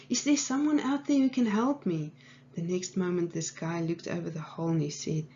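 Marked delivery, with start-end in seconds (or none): 0.1-2.1 high-pitched mock yell; retold scream. Trapped man hears footsteps and calls out, mild mock scream. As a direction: imitate a plea called upward, not full volume. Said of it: "he screamed again".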